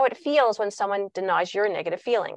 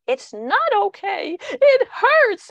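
high-pitched, slow wail of protest, getting louder; escalating child protest; 12 semitones higher, 7 dB louder, much slower than usual. Acted. Upset child arguing back at a soothing parent, high escalating protest. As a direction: act an upset child insisting, voice shooting up. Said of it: "they will escalate".

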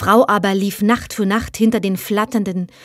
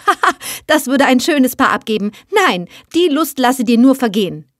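mocking laugh, quick and a little louder; 4 semitones higher, 3 dB louder than usual. Girl laughs at the tiny suitor, fast mocking laughter. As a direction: laugh him off like a silly joke. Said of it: "Da lachte Heideröslein hell auf".

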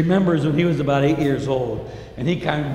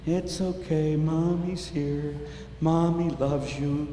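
soft, slow hushing lullaby voice; soothing mother; 6 dB quieter, slower than usual. Acted. Mother soothing her crying newborn, soft and slow. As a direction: hush a crying baby gently. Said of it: "hold his little body and say".

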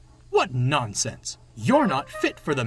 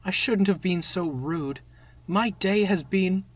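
higher rueful confession; sad; 9 semitones higher, 4 dB louder than usual. A narrator's reading. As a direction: own up regretfully in the engine's voice. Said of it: "said Thomas sadly".

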